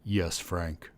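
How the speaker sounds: deep voice over speakers; booming ship computer; deep resonating voice. A narrator's reading. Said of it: "The deep and resonating voice".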